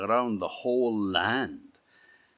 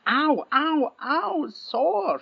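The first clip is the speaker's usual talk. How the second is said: squealing high cries of pain; high pained princess; 5 dB louder, much slower than usual. Acted. Princess hit while riding.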